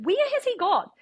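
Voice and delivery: high, frantic where-did-he-go; stressed panic. Retold or imitated. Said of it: "And I was real stressed".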